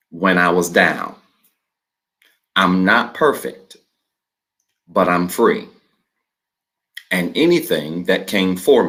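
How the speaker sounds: slow, measured reading with long pauses between phrases; slow declaration reading. Shown as a demonstration. Pastor leading the online congregation through the declaration, slow and measured. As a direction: read each phrase slowly and leave room for the congregation to repeat. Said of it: "I'm gonna read it slow as I always do".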